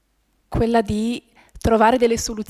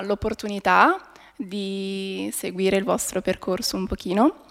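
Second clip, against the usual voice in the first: ordinary delivery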